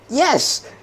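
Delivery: excited cheer. Real, not acted. Cheering a local man's idea.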